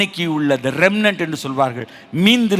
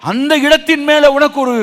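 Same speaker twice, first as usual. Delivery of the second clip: raised, higher-pitched sermon delivery; forceful preacher addressing the flock; impassioned preaching; 9 semitones higher, 5 dB louder than usual. Acted. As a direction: press the questions on the congregation with force.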